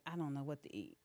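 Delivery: low, soft lost voice; helpless patient. Retold or imitated. Girls home after surgery, low and soft. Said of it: "they're like".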